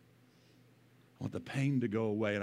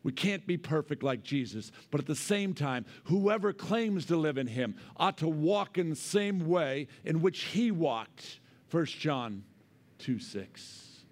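preaching; 5 semitones higher than usual. Acted. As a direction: preach it strongly. Preaching at full force.

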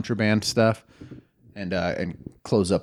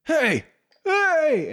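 guards yelling. Acted. GM voices guards spotting players.

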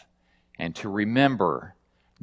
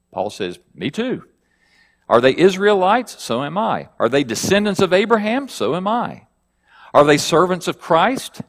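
higher, louder rhetorical questions, rising each time; scripture reading; 5 semitones higher, 4 dB louder than usual. Acted. Paul challenging his rivals' boasts, raised rhetorical questions.

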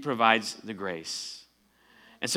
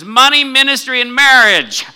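much higher and louder, each word landed; emphatic reveal; 13 semitones higher, 14 dB louder than usual. Retold or imitated. A friend naming what he has been praying for, emphatic and loud. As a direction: announce the three words like a revelation. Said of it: "He says".

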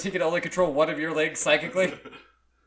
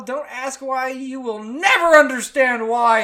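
higher, slow and louder, a mock Joker calm; creepy calm; 8 semitones higher, 8 dB louder, slower than usual. Reading the post aloud, the tattooed man answers the artist in a hammed-up creepy voice. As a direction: play it like an unhinged villain, slow and raised. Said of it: "and the creepiest smile".

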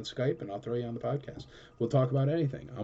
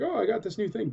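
much higher, squealing with mock delight; gushing brain meme; 10 semitones higher than usual. Brain meme gushing over its new toy, squealing excitedly. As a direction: play the giddy kid with a new toy. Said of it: "He's like".

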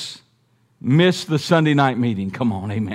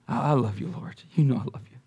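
humbled; 5 semitones lower, 9 dB quieter, faster than usual. Acted. Humbled Peter answering.

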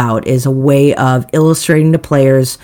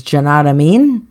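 Cockney attempt. Shown as a demonstration. Player reading out the last game phrase, Cockney attempt. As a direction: run together as Cockney "jnadamean". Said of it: "speaking the phrase with a Cockney".

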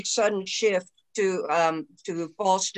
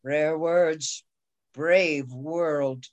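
usual speaking voice

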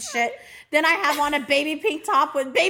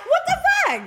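high-pitched incredulous squawk; laughing, high cry of disbelief; mock disbelief; 3 dB louder, faster than usual. Friend recounts being left in the dust, mock disbelief. Left behind, retold outrage.

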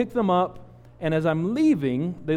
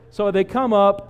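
usual speaking voice

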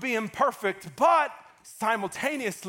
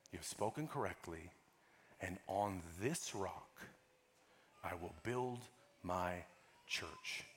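soft, much quieter storytelling voice; hushed story narration; 11 semitones lower, 16 dB quieter than usual. Acted. Preacher softly retelling Jesus and Peter to the church, hushed. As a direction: draw them in with a whispered story.

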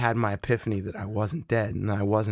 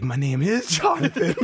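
laughing; 12 semitones higher than usual. Retold or imitated. Confused guy denying he's dead.

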